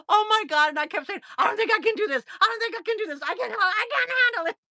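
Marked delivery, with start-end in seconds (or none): 0.1-0.7 retold panic. Retold panic during painful therapy. 1.4-4.6 high, repetitive mock panic; panicked protest. Patient on the table kept saying it, panicked protest. Retold panic during painful therapy.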